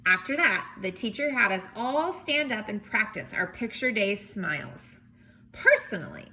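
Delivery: lively picture-book read-aloud; story narration. A narrator's reading. Teacher reading a picture book to kids, lively narration.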